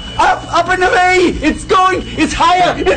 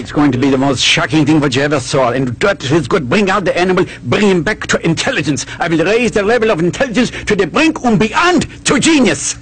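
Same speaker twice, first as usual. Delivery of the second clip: mad doctor; 9 semitones lower than usual. Acted.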